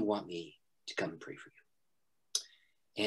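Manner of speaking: slow, hushed question; whisper. Retold or imitated. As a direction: whisper it, wary. Cautious question at hospital bedside.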